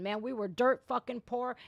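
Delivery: usual speaking voice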